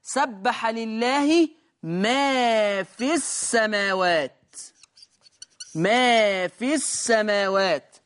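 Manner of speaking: slow, melodic chanted verse; slow, drawn-out Quran chant; Quran recitation. Shown as a demonstration. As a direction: recite the verse slowly in tajweed. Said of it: "قول الله تبارك وتعالى".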